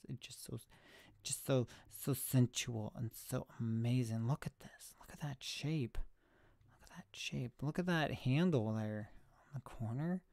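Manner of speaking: soft, near-whispered swooning; hushed adoration. Pretend. Streamer showing off a beloved camera, hushed mock-sensual admiration. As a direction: drop to a breath, savoring every detail.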